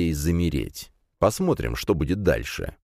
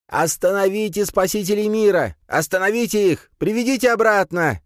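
high, frantic squeal; shrieking; 4 dB louder than usual. A narrator's reading. Priest orders warriors to stop the saviours, shrieking. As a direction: shriek orders after escaping prisoners. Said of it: "визжал жрец позади нас".

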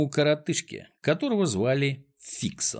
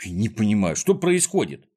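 plain reading voice

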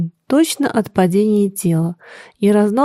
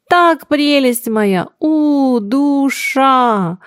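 slow, high, drawn-out adoration; gushing endearment; 5 semitones higher, much slower than usual. A narrator's reading. A reveller dotes on his friend, gushing endearment. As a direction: stretch the words out in effusive affection.

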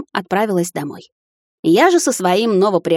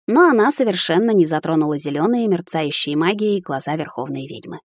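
plain reading voice

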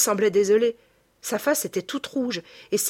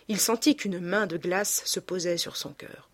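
ordinary delivery

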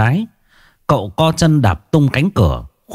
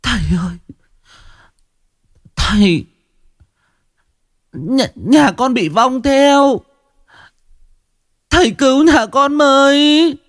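high, slow, choked pleading. A narrator's reading. Woman begging the shaman to save her family, choked up. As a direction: beg through a choked throat. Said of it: "nghẹn ngào".

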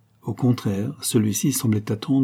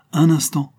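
high, louder call to wait; halting interjection; 7 semitones higher, 5 dB louder than usual. A narrator's reading. Kernan stopping the knight at the door, sharply halting. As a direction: cut in to stop him.

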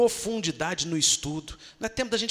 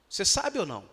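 normal talking voice

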